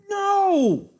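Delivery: shrieking high and loud in disbelief; panicked screaming. Real, not acted. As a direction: scream at the misclick. Accidental mouse-slip chess blunder.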